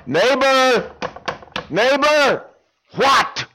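high, loud calls up; calling out. Acted. Man below calling up to the neighbor again, high and loud. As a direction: keep pestering.

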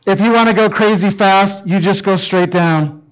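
ordinary talk

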